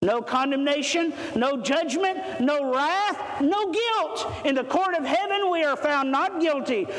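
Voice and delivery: fervent preacher building to a shout; preaching climax. Acted. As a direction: drive the list upward like a closing altar call.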